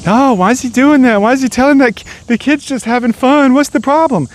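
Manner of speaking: high, whiny mock voice of a clueless onlooker; mocking whiny bystander. Mocking the onlookers who question the dad, high whiny voice. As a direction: sneer at the critics in a high sing-song whine.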